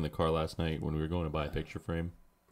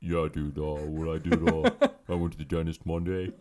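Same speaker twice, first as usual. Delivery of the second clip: lightly voiced slurry friend; a hint of a mumbling buddy; Anthony impression; 5 dB louder than usual. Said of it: "do your Anthony impression again".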